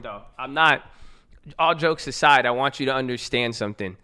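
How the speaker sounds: ordinary delivery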